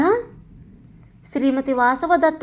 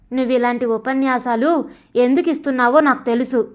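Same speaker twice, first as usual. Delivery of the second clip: ordinary delivery, nothing marked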